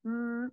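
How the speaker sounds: "mm" hummed with closed lips; m sound. Shown as a demonstration. Teacher to class, showing the sound M makes. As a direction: hum the m sound on its own. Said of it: "M says".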